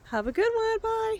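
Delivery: high, lilting sign-off; a cheery sing-song bye; sing-song goodbye. Real, not acted.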